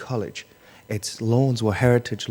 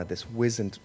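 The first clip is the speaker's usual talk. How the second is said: ordinary talk, nothing marked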